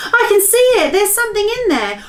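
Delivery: put-on very high, loud girl's cry; excited cry. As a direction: Sophie shouts at what she sees. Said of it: "cried out".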